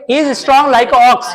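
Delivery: slow, word-by-word reading of "He is strong like ox" with "strong" leaned on; slow dictation read. Shown as a demonstration. Teacher reading the sentence slowly to the class, deliberate dictation. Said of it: "क्या लगाओगे?".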